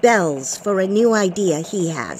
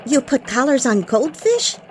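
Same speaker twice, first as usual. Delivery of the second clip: surprised; 3 semitones higher than usual. Acted. Anchor reacts to goldfish collars.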